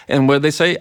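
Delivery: ordinary delivery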